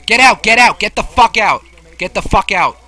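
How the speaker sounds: yelling to get out; loud angry yell; shouting. Real, not acted. Yelling at player in game.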